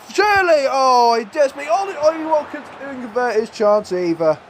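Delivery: high-pitched cry at a missed chance; exasperated excitement. Real, not acted. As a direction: call the miss in urgent disbelief. Reacts live to a missed chance.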